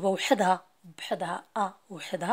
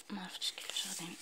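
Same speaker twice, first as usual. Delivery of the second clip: quick whisper to himself, fast; whispered aside; 15 dB quieter, faster than usual. Real, not acted. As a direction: mutter it under your breath, unsure. (همس سريع مع نفسه)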